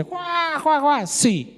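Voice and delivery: high mock cackle juá juá; imitating a laugh. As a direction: laugh it out like the gleeful prosecutor. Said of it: "Y se empezó a reír".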